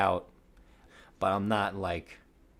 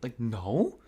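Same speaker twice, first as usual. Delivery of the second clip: shocked denial; 2 semitones higher, slower than usual. Retold or imitated. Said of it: "I was like".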